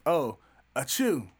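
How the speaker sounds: slow put-on achoo sneeze; fake sneeze. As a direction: act out a sneeze, drawn out. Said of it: "That's when you sneeze".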